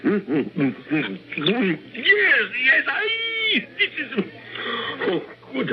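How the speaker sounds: high, crying-out rapture over the food; ecstatic swoon. Acted. Dictator crying out in rapture over Pilar's cooking. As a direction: squeal with delight at the taste.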